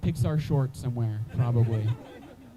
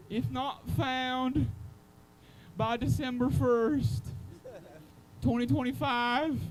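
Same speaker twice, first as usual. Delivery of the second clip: higher, slow read of a lost-cat poster; missing cat poster; 10 semitones higher, 4 dB quieter, slower than usual. Retold or imitated. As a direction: recite it like a solemn notice, drawn out. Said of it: "Just like".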